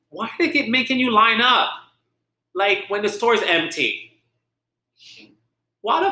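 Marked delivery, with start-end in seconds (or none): none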